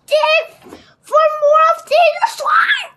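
high, loud yell of an order; shouted command. A narrator's reading. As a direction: bellow it at the diggers, pitched high. Captain yelling orders at pirates.

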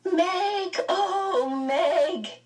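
put-on very high child's cry; high relieved cry. A narrator's reading. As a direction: call out to his sister. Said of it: "Charles Wallace was crying out".

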